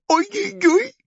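very high string of oys; high alarmed yelps; yelping. Acted. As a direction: squeal as if bouncing from jabs. Crocodile jumps again.

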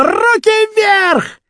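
high, long-drawn police shout; shouted command. A narrator's reading. Captain Boldyrev bursts in on the gang, shouting. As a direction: bellow the order, stretching it out. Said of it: "и Болдырев крикнул".

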